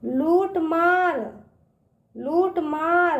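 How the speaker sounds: slow लूट मार, long vowels held; drawn-out long ū. Shown as a demonstration. Teacher drilling children, लूट मार with long ū. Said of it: "इसका उच्चारण ना खींच के करते हैं".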